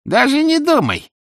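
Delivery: loud, high, emphatic warning; stern well-wisher. A narrator's reading. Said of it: "строго сказал".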